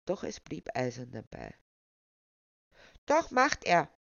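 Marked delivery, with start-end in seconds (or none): 3.1-3.9 put-on high, loud child voice; insistent child. A narrator's reading. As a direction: insist stubbornly like a small child. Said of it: "blieb das Kind beharrlich".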